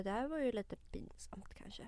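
hushed, embarrassed whisper; whispered aside. Real, not acted. Friend whispers an embarrassing admission mid-story. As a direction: drop to a whisper, a bit ashamed.

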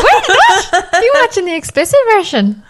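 very high, laughing disbelief; mock shock. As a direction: play up the startled double take. Retelling a mistaken, startled reaction.

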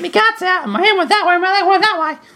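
very high squeaky child imitation; kid from the ad. Redoing the kid in the ad, very high child voice. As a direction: pitch it way up as a little kid. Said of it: "The other kid in the ad".

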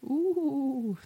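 high, eerie drawn-out ooh; haunt it with a ghostly ooh; spooky voice. Acted. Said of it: "that's my spooky voice".

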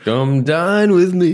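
high, drawn-out sing-song; sing-song show title. Acted. Co-host echoes the TV show's name, sing-song.

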